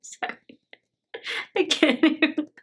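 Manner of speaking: helpless giggling through the words; real laughter. Real, not acted. Reactor overcome by the dinner-in-the-bridge joke, really laughing. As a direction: try to apologize while cracking up. Cracks up over the bridge line.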